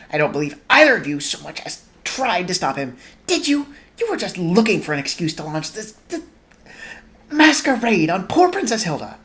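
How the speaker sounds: a touch of heated scolding; passionate reproach. Acted. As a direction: scold him with rising passion. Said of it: "Sophie said passionately".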